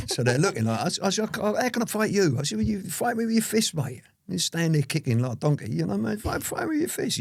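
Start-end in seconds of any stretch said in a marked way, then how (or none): 1.3-2.4 higher, exasperated question; indignant protest. Retold or imitated. Retold protest to kicker. 2.6-7.2 higher, exasperated question; indignant protest. Retold or imitated. Retold rant at kicker.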